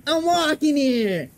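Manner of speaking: hammed-up Brooklyn accent. Shown as a demonstration. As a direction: stretch it out like an indignant Brooklynite. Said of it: "the Brooklyn accent".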